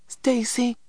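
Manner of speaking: put-on high, urgent call of a child; excited. A narrator's reading. Little brother appeals to the eldest, excitedly. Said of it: "said little man excitedly".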